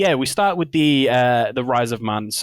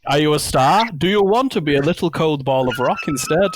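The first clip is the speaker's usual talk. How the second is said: mock menacing; 5 semitones higher than usual. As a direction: threaten it like a hammy overlord. Mock threat to the star.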